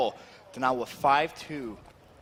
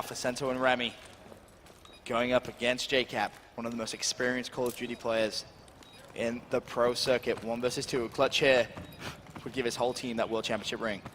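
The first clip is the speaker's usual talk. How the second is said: esports caster; 5 semitones lower, 5 dB quieter than usual. Real, not acted. Low dramatic build-up call.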